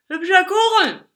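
put-on high, loud slurred drunk; boozy slurred compliment; drunken slur. A narrator's reading. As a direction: bellow it tipsily, words running together. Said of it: "lallte der Betrunkene".

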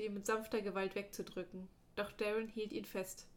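plain reading voice

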